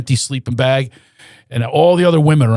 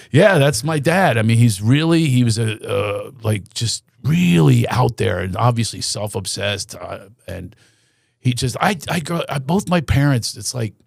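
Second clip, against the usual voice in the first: ordinary talk, nothing marked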